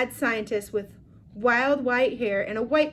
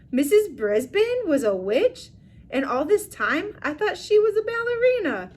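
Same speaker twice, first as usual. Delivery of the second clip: surprised; 8 semitones higher, 3 dB louder than usual. Acted. Humphrey's surprised reaction.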